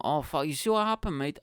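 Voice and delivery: normal talking voice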